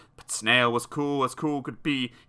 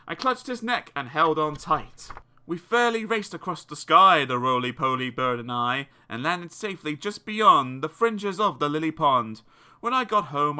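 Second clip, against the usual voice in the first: usual speaking voice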